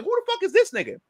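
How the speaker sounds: very high-pitched disbelief; incredulous demand, voice jumping way up; retold outraged question. Repeats challenge to the Japanese woman.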